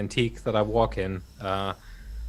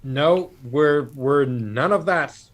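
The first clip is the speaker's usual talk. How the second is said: raised, higher-pitched declaration; acted calling out; 5 semitones higher, 5 dB louder, slower than usual. Player pleading with the crypt's voice, slow raised call. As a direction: call out slowly to an unseen spirit over howling wind. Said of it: "I'll yell at the".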